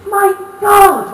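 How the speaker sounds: high, loud shriek of pain; scream. Acted. Old wife shrieking after being stood on, loud and high. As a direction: shriek it out in agony. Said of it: "Mabel screamed".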